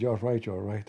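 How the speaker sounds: low, quiet, sheepish agreement; a hint of a chastened follower; meek admin. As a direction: concede quietly like a scolded man. Admin voiced meekly.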